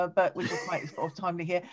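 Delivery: ordinary delivery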